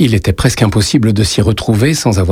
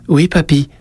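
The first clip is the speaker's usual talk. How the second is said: put-on high child voice; little boy; 8 semitones higher than usual. A narrator's reading. Boy answers his grandfather, high child voice.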